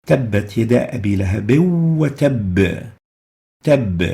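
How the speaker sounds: final ba of "وتب" held doubled, then bounced hard; "وتب" as watabb-uh, stressed shadda then release; high qalqala. Shown as a demonstration. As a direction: stop on "وتب" with a strong echoing b. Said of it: "the strongest form of qalqala".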